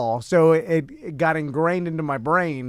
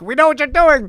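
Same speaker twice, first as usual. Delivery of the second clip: calling through a door; 9 semitones higher, 5 dB louder than usual. Retold or imitated. Teasing a friend through the door.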